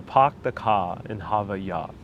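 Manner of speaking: low, soft Boston accent. Shown as a demonstration. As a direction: drop every r like a Bostonian. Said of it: "in order to learn the Bo-, Boston accent".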